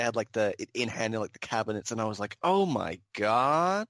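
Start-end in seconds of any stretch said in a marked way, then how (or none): 2.4-3.9 drawn-out, louder "oh my god" of awe; slow, swooning fan reaction; awed reaction. Retold or imitated. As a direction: retell being blown away, stretching each word. Retold reaction to seeing the toy.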